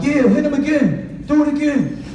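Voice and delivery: high, eager cheering on; egging on a fight. Retold or imitated. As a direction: hype up the fighters like a rowdy kid. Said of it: "I'd be like".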